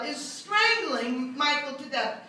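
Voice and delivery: ordinary talk, nothing marked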